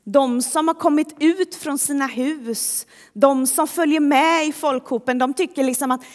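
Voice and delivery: usual speaking voice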